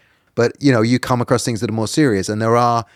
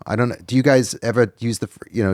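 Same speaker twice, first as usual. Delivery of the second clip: usual speaking voice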